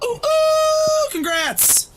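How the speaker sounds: high, loud squeal of congrats; excited cheer. Real, not acted. Friend cheering the couple's anniversary, squealing high.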